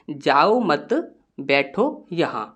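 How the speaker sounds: जाओ मत as one unit, break, बैठो यहाँ; group मत with जाओ, not with बैठो; pause after मत. Shown as a demonstration. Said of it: "comma यहाँ पर लगा हुआ है मत के बाद".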